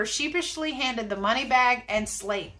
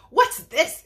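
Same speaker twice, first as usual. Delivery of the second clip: mild startled exclamation, raised pitch and volume; exclaiming; 4 semitones higher, 6 dB louder than usual. A narrator's reading. Storekeeper bends over the fallen bird, exclaiming. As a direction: exclaim it, a touch taken aback. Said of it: "exclaimed the storekeeper".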